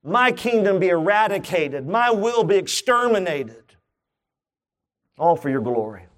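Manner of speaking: slow, surrendered prayer; fervent new prayer. Pretend. Preacher to church, voicing a fervent surrender prayer. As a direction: pray it like giving everything up. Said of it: "here's our new prayer".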